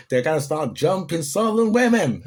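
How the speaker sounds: high, loud mocking parody of a threat; mocking quote. Mocking the video's threat to southern women in a high, loud voice. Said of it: "Have you seen that video".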